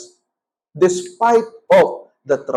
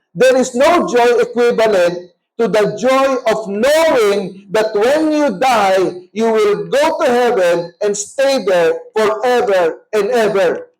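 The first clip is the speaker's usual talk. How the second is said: slow emphatic preaching; 4 semitones higher, slower than usual. Acted. Preacher slows on heaven promise.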